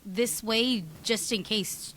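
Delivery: ordinary delivery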